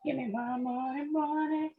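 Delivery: high, pleading for money. Real, not acted. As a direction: beg the stock to pay out. Trader begging stock to rise.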